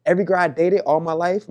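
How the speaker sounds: normal talking voice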